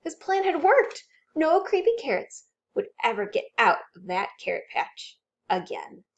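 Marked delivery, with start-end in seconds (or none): none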